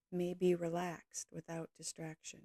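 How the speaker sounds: ordinary delivery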